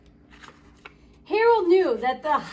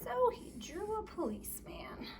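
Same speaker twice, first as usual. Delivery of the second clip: unvoiced whispered aside; 3 semitones higher, 13 dB quieter than usual. Real, not acted. Whispering to the child while drawing. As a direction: breathe it like a secret.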